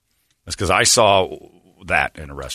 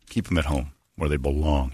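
normal talking voice

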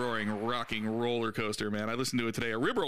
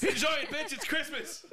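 mock cheer; 13 semitones higher than usual. Mock festive outburst.

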